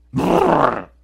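loud rolled rumbling burst; imitated collapse rumble. Preacher mimics the tower coming down, loud rumble. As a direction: roll out a loud crash noise. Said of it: "ruido ensordecedor".

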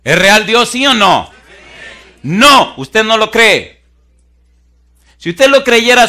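loud, high shouted sermon; full-force preaching. Real, not acted. Preacher challenges congregation's belief in God, shouting fervently.

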